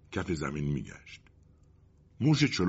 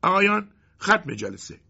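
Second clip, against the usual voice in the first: character's closing call; 13 semitones higher, 6 dB louder than usual. Acted. End of a character's speech.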